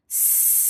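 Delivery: S as one long, continuous unvoiced "sss"; stretched S sound. Shown as a demonstration. Teacher showing pupils how to stretch it, long held S. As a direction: hold the S hiss out long. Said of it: "You can stretch out the S sound like this".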